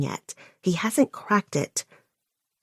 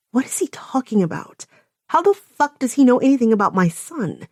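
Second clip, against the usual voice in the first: ordinary delivery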